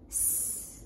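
soft, drawn-out snake hiss. A narrator's reading. Storyteller imitating the big scary snake, hissing. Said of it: "wiggled and jiggled and hissed".

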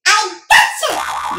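loud, drawn-out catch cry; triumphant yell. A narrator's reading. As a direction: yell it as you pounce. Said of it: "yelled Emmett".

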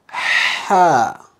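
Ha: breathy throat h, said again; Ha, air from the throat; Arabic ha. Shown as a demonstration. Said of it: "Say that one more time".

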